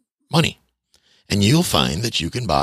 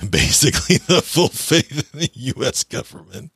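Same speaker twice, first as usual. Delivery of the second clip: barely getting the phrase out while cracking up; laughing through it; 9 semitones higher than usual. Real, not acted. Calling the dollar backed by faith, laughing helplessly. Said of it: "I can't say it without laughing".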